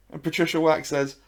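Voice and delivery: ordinary delivery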